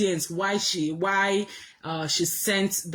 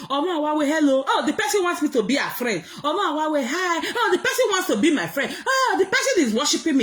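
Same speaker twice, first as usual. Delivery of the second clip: high, fast, preening voice with laughter; giggly mock boast of being adored; mocking Lolo; 6 semitones higher, faster than usual. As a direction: gush like someone showing off her fans. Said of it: "That is why you feel".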